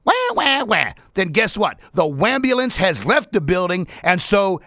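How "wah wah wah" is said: high-pitched cartoon whining and squawking; hammed-up, high crybaby griping; mock squawking complainers. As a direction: imitate whiners with squawks and wails. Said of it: "they just wanna squawk".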